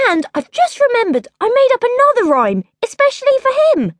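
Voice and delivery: high, quickening sly eagerness; sly girl. A narrator's reading. Princess tricking her mother with a fake rhyme. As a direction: sell the idea innocently.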